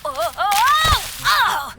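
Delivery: loud, high drawn-out cries of losing balance; alarmed slipping yelps. Acted. Boy slipping on ice in front of his friends, alarmed yelping. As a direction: yelp as your feet go from under you.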